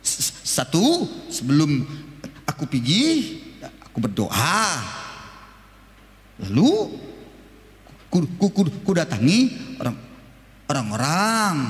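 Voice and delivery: halting stutter through the whole account; mock stammer, broken and slow; stuttering salesman. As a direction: stumble on each first sound. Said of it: "Dia bilang begini saja".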